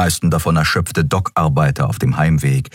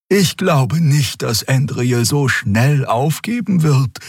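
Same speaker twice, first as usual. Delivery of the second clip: higher, slow doubtful voice; Xea's voice; 9 semitones higher, slower than usual. A narrator's reading. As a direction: let her doubt sound high and careful. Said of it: "sagte sie".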